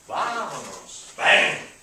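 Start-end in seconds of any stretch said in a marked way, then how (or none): 1.2-1.7 loud breathy "bam"; loud exclamation. Shown as a demonstration. Teacher punctuates the list with a loud bam. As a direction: blurt it out like a hit. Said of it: "accent punctuation".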